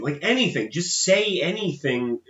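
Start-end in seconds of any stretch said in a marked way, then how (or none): none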